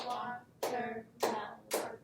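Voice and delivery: Watermelon slowed, wa-ter-mel-on, each beat apart; clapped out syllables. Shown as a demonstration. Teacher claps out Watermelon syllable by syllable for the class. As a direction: clap out Watermelon slowly. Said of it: "These words are easily clapped out".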